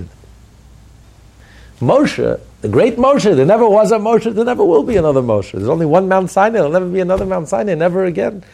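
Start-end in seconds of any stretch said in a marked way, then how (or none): 1.8-8.4 higher and louder, soaring with awe; fervent preaching. Acted. Rabbi extolling Moshe to his listeners at full force. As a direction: proclaim Moshe's greatness to the rafters.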